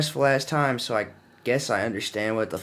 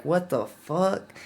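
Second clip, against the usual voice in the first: slow, high, dazed what-the-fuck; retelling his own shocked reaction; stunned disbelief; 8 semitones higher, much slower than usual. Retold or imitated. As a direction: drag it out in shocked confusion. Waking up, told he died.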